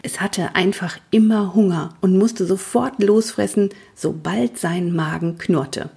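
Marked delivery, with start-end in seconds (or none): none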